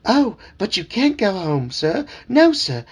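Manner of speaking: high, fussy talking frog calling him sir; chirpy, insistent little frog servant; eager frog voice. Acted. Dad voicing the talking frog for his kids, high and eager.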